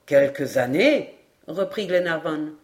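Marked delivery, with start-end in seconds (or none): 0.1-1.1 slow, low questioning repeat; low-pitched, drawn-out query; doubtful echo. A narrator's reading. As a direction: repeat it slowly, rising into a question. Glenarvan doubts the sergeant's date.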